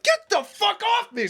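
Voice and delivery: very high, loud panicked shout; panicked yell. Retold or imitated. Pinned by his boss, high panicked yell. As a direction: shriek to get him off. Being choked from behind.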